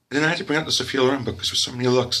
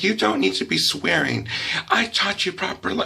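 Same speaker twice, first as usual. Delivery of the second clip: higher-pitched reprimanding mom; mock motherly scolding; 6 semitones higher than usual. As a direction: imitate a mother telling off her child. Mother scolds child for swearing.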